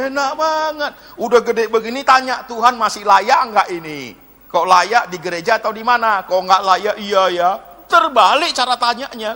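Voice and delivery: loud mocking reproach; indignant preaching. Real, not acted. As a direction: scold the congregation with incredulous force. (khotbah menyindir dengan lantang)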